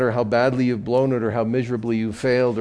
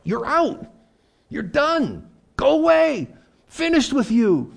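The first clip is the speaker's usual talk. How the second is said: higher, louder, cutting him off; mock angry dismissal; 5 dB louder, slower than usual. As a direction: throw him out with a mock rage. Imagined Jesus dismissing Peter.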